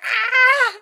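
a high loud cry of pain; vampire yelping as his finger burns. Retold or imitated. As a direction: yelp as if burned.

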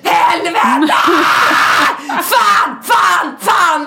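high, loud furious swearing; cursing at the top of your voice; screaming curses. Retold or imitated. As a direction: let it all burst out in a scream. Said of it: "Vad står och skriker".

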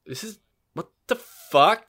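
high, drawn-out what the fuck; stunned disbelief. Retold or imitated. Telling a friend about the drive to Santa Monica, stunned disbelief. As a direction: gape at the street like it's a game. Retelling arrival in Los Angeles.

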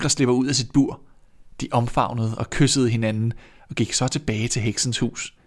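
plain reading voice

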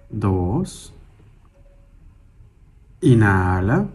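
slow single-word cues, each vowel drawn out; calm, measured breath counting with long silences; guided breathing meditation. Acted. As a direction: pace someone's breathing, stretching each cue unhurriedly.

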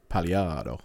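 Pagliaro with a soft Italian r, Italian accent; Italian pronunciation. Shown as a demonstration. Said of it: "if you say it in an Italian accent".

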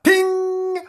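high sung ping; ping it like a bell; high ping sound. A narrator's reading. Sound effect of key.

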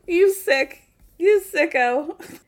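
hammed-up disgust, drawn out; mock disgust. Mock outrage at Robin.

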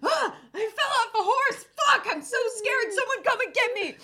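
high, loud, hammed-up cry for help; mock panic. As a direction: imitate yourself panicking, laughter creeping in. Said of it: "And I panic".

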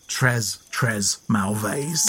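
quiet, slow, mock French; très mauvais, said softly in slow schoolboy French. Host joking about his own poor French in mock French. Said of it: "you know my French".

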